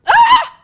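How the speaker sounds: high, loud mock shriek; mock scream. Lecturer acts hearing your own recording, loud mock scream. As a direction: let out a short hammed-up shriek.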